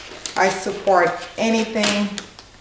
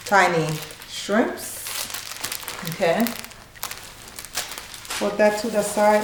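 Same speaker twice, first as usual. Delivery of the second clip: normal talking voice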